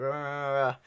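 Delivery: lightly voiced Wookiee rah sounds; a hint of a Wookiee growl; mock Wookiee growl. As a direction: imitate a Wookiee roar, half-hearted. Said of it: "so all you see is, like".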